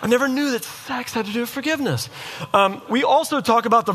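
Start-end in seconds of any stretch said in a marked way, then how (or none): 0.0-2.1 high-pitched, thrilled outburst imitating the crowd; mock excited audience. Preacher imitating listeners thrilled by a sex lesson, mock excited. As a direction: play a delighted listener, voice jumping up high. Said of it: "You guys were like".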